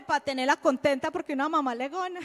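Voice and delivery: normal talking voice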